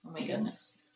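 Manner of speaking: awed whisper. Real, not acted. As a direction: breathe it out in a whisper. Repeats the exclamation in a whisper.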